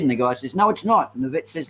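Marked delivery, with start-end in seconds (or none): 0.5-1.1 insistent denial. Acted. Dog owner disputes the vet, acted.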